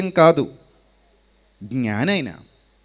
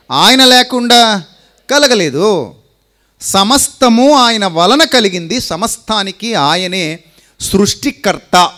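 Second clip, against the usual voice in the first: rising, louder preaching, stretched out slow; 4 semitones higher, 6 dB louder, slower than usual. Acted. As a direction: proclaim it from the pulpit, drawing out each phrase. Preacher proclaims the creator. (బిగ్గరగా ప్రసంగం)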